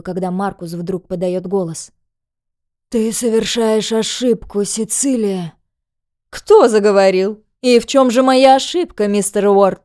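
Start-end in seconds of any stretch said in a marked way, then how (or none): 2.9-5.5 strained wounded man. Acted. Wounded Marcus speaks in pain. 6.3-9.8 loud, taunting female villain; mocking villainess. Villainess mocks Marcus.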